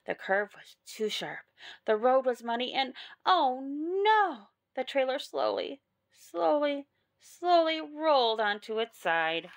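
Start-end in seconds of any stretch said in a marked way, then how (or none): 3.2-4.5 long, swooping cry of alarm; higher, louder, stretched-out alarm; dismayed exclamation. A narrator's reading. Narrator sees the trailer tipping on muddy road, dismayed exclamation. 4.8-9.5 drawn-out, deliberate words with pauses; suspenseful slow telling. A narrator's reading. Narrator tells of trailer tipping over, suspenseful slow telling.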